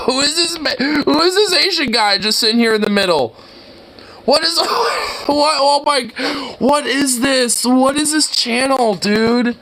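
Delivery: high-pitched, half-laughing disbelief; incredulous bewilderment. Real, not acted. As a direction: demand an explanation in a high, baffled voice. Bewildered, laughing at odd video.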